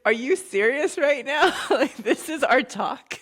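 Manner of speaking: loud, high incredulous protest through laughter; laughing disbelief. Retold or imitated. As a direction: protest in mock outrage, slowing at the end. Retold disbelief at mom's curt response.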